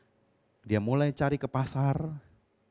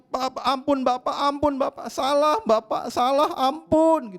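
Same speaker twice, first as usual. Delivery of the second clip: high, slowing, pleading cries; cornered son crying out to his father; 9 semitones higher, 3 dB louder, slower than usual. Retold or imitated. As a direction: beg louder and slower each time. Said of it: "dia terus teriak-teriak".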